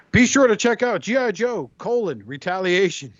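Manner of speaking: an imitated radio promo spot. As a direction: sell it like a talk-show sponsor read. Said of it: "they would promote this".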